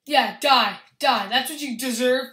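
loud gloating. Real, not acted. Gloating as enemy dies.

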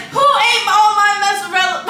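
loud, high accusing wail; mock outraged Kevin. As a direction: demand it like a loud, outraged victim. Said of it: "you can be like".

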